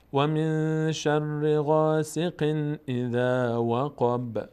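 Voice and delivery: drawn-out chant; tajweed chant; slow recitation. Shown as a demonstration. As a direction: recite word by word. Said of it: "recitation example".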